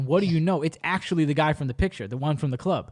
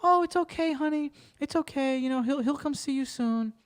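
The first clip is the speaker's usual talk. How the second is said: much higher, sweet reassuring aunt voice; soothing aunt; 8 semitones higher, 3 dB quieter than usual. Retold or imitated. The aunt reassuring her on the phone, high and soothing. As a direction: coo it like an aunt calming a girl. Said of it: "She'd be like".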